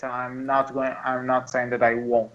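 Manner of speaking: normal talking voice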